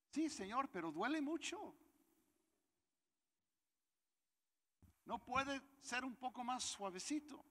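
high pitched childish mock whine; whiny complaint. Preacher answering God's reply, high mock whining. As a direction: bargain with God like a whining kid. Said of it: "Pero me dijo".

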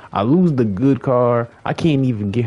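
real exasperation; frustrated shout. Real, not acted. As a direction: wail about the lost car. Lost his car in the game.

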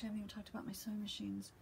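quick, whispered aside; hushed aside. Real, not acted. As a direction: whisper it fast, as if just remembering. Hurried aside to herself.

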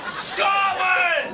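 defiant shout. Acted. Trapped Scot joining his friend's chant, defiant shout. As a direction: shout it like a rallying cry.

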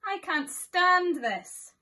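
slow, high, loud wail of despair; slow exasperated groan of a tired dad bear; exasperated Mister Bear. Acted. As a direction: drag it out like a bear at the end of his rope. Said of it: "said Mister Bear".

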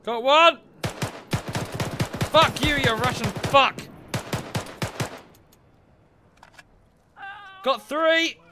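loud, triumphant gamer yell; excited shout. Real, not acted. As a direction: yell out each kill in triumph. Firefight kills shouted.

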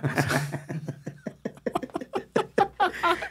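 a big high burst of laughter that falls away. Real, not acted. Interviewer laughs at a friend's three divorces. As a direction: crack up at the joke.

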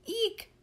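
very high computer squeal; frightened squeak. A narrator's reading. Threatened computer reacts to Ringo, squeaking. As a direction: squeak "eek" in alarm. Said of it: "said the computer".

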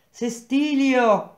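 high, loud, slow drawn-out call; a wife shouting her husband's name down the street. Acted. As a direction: call him in from the window, long and loud. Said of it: "costei gridò".

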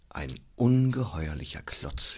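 usual speaking voice

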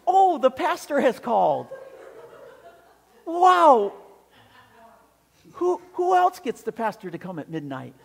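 thrilled the pastor came; overjoyed. Retold or imitated. Jim greeting the pastor at midnight, gushing with joy. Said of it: "he is just overjoyed".

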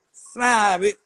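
barked order to smile. Shown as a demonstration. Ordering a friend to smile, loud demand. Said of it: "they can't do a smile".

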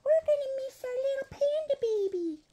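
soft, very high pretend whimper; tiny mock-tearful panda voice; crying toy pandas. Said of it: "She go".

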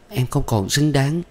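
high tearful cry; sobbing. A narrator's reading. Thuận sobbing to Tuyển that she is unworthy, voice high. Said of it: "Nàng khóc nức nở".

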